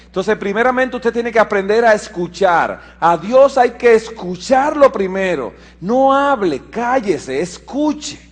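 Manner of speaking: rising, insistent sermon voice with the pitch climbing high; preaching. Acted. Pastor urging the church to listen to God, insistent preaching. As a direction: preach it, driving each command home.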